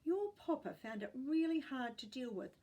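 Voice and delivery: ordinary delivery, nothing marked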